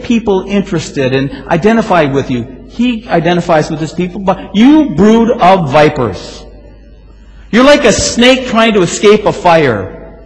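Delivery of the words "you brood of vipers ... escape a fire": a fierce howling prophet's warning; fiery rebuke. Acted. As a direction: rail at the crowd like a prophet. Preacher voicing John's rebuke.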